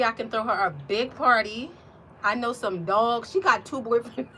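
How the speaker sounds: normal talking voice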